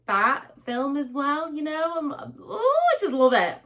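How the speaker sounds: high, swooning gush. Real, not acted. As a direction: melt with love for the film. Gushing about a beloved film.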